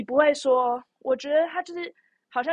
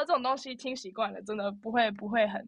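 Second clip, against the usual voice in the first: ordinary talk, nothing marked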